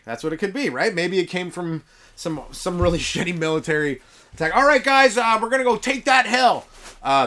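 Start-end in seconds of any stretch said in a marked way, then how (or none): 4.5-6.6 loud, high commanding general; barking general. Retold or imitated. General ordering his soldiers to take the hill, loud. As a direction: order the troops up the hill loudly. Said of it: "I'm the general".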